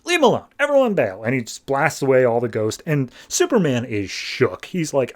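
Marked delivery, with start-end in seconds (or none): 0.0-1.2 high-pitched mock command; pitched-up imitation of a rescuer stepping in; retold warning call. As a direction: call out a quick, half-hearted order. Rescuer orders ghosts away.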